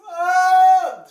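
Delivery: very high, loud mock shriek; imitated scream. Recalling the raptor scene to a friend, imitated shriek. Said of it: "It was gross".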